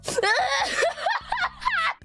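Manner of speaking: piercingly high burst of real laughter; high helpless laugh. Real, not acted. Storyteller collapsing after the mock lament, laughing. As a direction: break down laughing.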